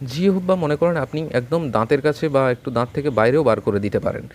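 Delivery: ordinary talk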